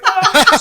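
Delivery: loud, high exasperated "oh"; upset groan. Retold or imitated. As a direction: let out a hammed-up wounded cry. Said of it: "I got upset".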